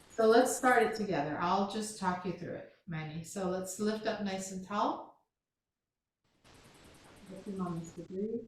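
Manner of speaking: soft, calm class instructions; yoga cueing. Acted. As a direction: guide the student gently through the flow. Talks Manny through the sequence.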